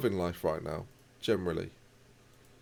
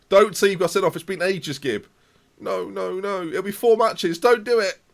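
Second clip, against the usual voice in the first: loud pleading; 8 semitones higher, 6 dB louder than usual. Real, not acted. Fearing a red card.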